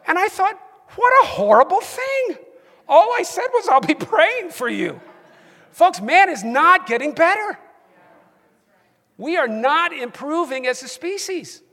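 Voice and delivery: loud, high-pitched outrage; indignant. Real, not acted. Indignant at a hurtful online reply.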